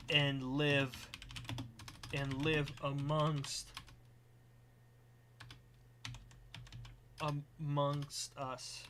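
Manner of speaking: slow, low, soft dictation; composing aloud. Real, not acted. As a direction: murmur it slowly while writing it out. Composes stream announcement aloud.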